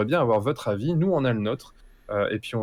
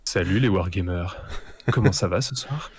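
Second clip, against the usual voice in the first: lower, smooth and suave; 5 semitones lower than usual. Pretend. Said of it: "je prends une voix suave".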